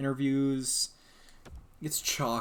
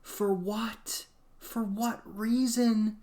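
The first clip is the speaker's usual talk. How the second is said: high, pleading disbelief; stretched-out exasperated wail; exasperated high cry; 12 semitones higher, 5 dB louder, much slower than usual. Real, not acted. Exasperated outburst at the game.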